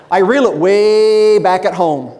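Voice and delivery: loud, with a long drawn-out WAY; exaggerated stage voice. Speaker jokes to audience about his home voice, exaggerated and loud. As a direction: stretch it out big and loud for the joke.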